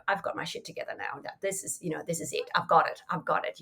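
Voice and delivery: smug hushed self-congratulation; quiet smug certainty; self-righteous. Retold or imitated. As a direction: whisper it like you've finally got it together. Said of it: "slight self-righteousness".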